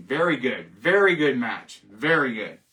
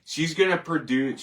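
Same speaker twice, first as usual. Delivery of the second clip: normal talking voice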